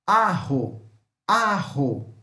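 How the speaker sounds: "Arro" with the Spanish J as an aspirated R; slow model of "Arro", as A-ho; J as R sound. Shown as a demonstration. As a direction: say "Arro" with a breathy h for the J. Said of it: "J ou rota tem som de R".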